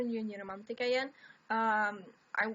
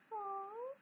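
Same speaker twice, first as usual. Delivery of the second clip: tender aww; 10 semitones higher, 9 dB quieter than usual. Real, not acted. Pitying the bitter cat.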